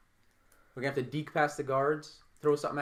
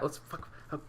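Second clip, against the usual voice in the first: whispered curse; 12 dB quieter than usual. Acted. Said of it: "I whispered him though".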